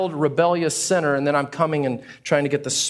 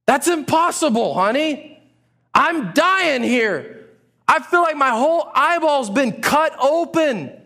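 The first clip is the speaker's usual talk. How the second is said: mock anguish; 9 semitones higher than usual. Person with eye pain whining to spouse in hammed-up anguish. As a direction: overplay the suffering.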